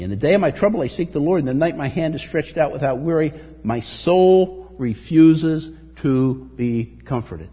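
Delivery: forceful reading, slowing at the end; preaching scripture. Acted. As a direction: build the verse, then drag the last line. Reads psalm with force.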